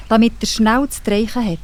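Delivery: ordinary delivery, nothing marked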